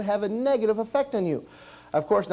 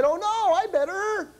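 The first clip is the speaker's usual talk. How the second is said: high, panicky puppet cry, much higher; a puppet yelping in alarm as his head shrinks; Rudy's panic; 10 semitones higher than usual. Acted. Puppet Rudy squeals at his shrinking head, high alarm. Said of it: "so he said".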